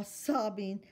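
ordinary delivery, nothing marked

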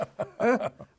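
a high, loud laugh breaking out; hearty laugh; loud burst of laughter. Real, not acted. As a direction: laugh out loud at your own jab. Laughs after teasing his co-host.

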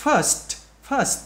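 "First" ending on a released S and T; crisp st ending. Shown as a demonstration. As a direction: release both S and T at the end of "First". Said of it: "bung cho được âm S và âm tờ". (bật rõ âm S và T cuối "First")

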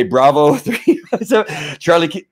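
breaking into laughter over the plate; laughing dispatcher. Retold or imitated. Dispatcher laughing as she reads her own plate number. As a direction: read it out, cracking up.

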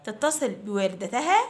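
ordinary delivery

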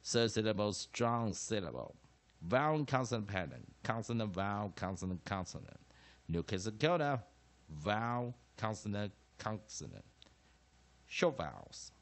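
measured classroom recitation in separate phrases; chanted phonics drill, firm on each term; syllable practice drill. Shown as a demonstration. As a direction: recite each rule like a drill for students to repeat. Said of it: "我们做最后一次练习音节的方式".

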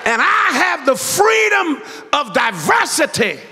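tune it up and holler to the church; full-force preaching. Acted. Preacher hollering to his congregation about God's freedom.

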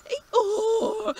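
loud, high braying Eeyore; long falling donkey bray. Acted. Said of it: "went the donkey".

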